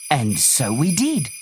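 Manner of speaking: plain reading voice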